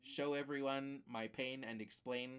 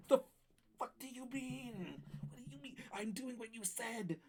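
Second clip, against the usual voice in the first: strangled mock outrage; 10 semitones higher than usual. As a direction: stretch it into a tiny high-pitched protest. Escalated retort to the game.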